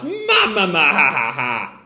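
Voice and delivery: Big Mom laugh. Acted. Big Mom mocks fallen Kid with laughing. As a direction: laugh like a giant pirate queen. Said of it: "And Big Mom laughs".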